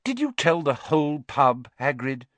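exasperated telling-off. A narrator's reading. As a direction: scold him in exasperation. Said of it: "said Professor McGonagall exasperatedly".

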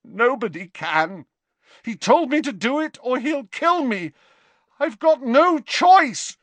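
put-on high, frightened teenage voice; desperate Malfoy. A narrator's reading. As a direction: plead with terror rising, the last words slowed.

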